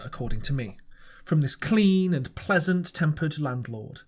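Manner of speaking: usual speaking voice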